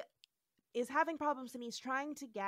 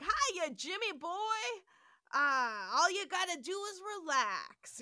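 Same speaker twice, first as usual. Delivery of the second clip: mock drawling McCoy; 7 semitones higher, 4 dB louder than usual. Spore-affected McCoy voiced in recap.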